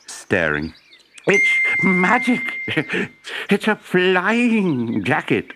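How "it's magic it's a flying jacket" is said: high, slow, delighted professor calling out; excited professor calling. A narrator's reading. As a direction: call out in wonder at the magic. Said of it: "the professor called".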